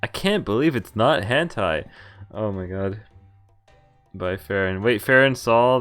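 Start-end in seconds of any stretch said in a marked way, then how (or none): none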